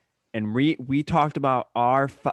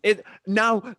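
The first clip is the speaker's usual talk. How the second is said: ordinary delivery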